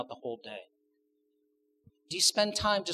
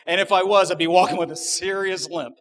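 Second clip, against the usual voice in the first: loud, raised comic delivery; higher and much louder, for comic effect; emphatic joking punchline; 6 semitones higher, 12 dB louder than usual. Real, not acted. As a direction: belt out the punchline. Loud joking punchline about wife.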